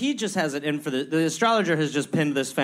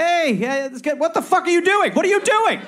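high, rising, louder alarm; mock freakout; 12 semitones higher, 4 dB louder than usual. Cohost voicing the prisoner reacting to the king, building to a high yell. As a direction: build from fake cheer to a shriek. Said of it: "you're probably like".

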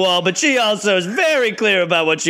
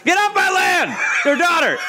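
protective dad yelling; 11 semitones higher than usual. Acted. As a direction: yell like a farmer guarding land.

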